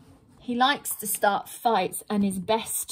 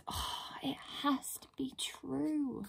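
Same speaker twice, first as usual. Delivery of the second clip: breathy resigned whisper; sighing whisper; 3 semitones higher, 14 dB quieter, slower than usual. Acted. Pixie whispers to herself, resigned to telling the truth. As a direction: whisper it with a defeated sigh. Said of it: "The Truth Pixie sighs".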